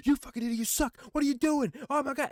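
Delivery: high, loud fake trash-talk rant; playful mock yelling. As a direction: pretend to rage at a friend for fun. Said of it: "we'll pretend to yell at each other".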